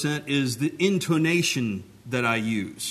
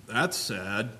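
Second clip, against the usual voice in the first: "That's sad" said low and soft; understated inflection; 4 semitones lower, 6 dB quieter than usual. Shown as a demonstration. Preacher demonstrates another meaning, "That's sad" low and soft. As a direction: drop "That's sad" quietly, in a lower voice. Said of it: "Just with the inflection of my voice".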